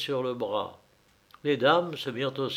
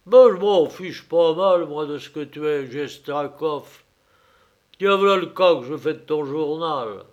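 scornful masked bully; 3 semitones higher, 8 dB louder than usual. Acted. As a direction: sneer at the banker. Masked man scorns the director. (mépris grossier du fêtard masqué)

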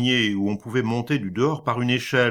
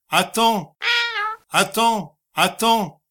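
threatening parrot; 11 semitones higher than usual. Acted. Parrot mimicking the dead husband to threaten the wife, menacing squawk. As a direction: threaten her like the bullying husband.

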